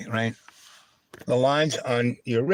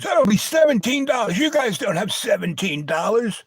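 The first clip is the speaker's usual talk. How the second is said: smug diner guy; 10 semitones higher than usual. Retold or imitated. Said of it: "And he goes".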